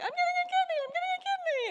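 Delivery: very high, loud joyful shout; ecstatic shout. Retold or imitated. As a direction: scream the news with pure joy. So I'm over here like.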